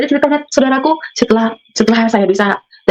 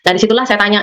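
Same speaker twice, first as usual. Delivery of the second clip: ordinary talk, nothing marked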